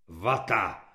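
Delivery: low, grumpy grunt; surly grunt. A narrator's reading. Burly kulak reporting to the corporal, surly grunt. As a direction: grunt it out grudgingly, pitched well down. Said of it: "zachrochtal kulak Nevrle".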